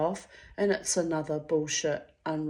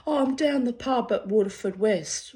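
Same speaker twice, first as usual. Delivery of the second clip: mock caller; 6 semitones higher, 3 dB louder than usual. Ex voiced from pub.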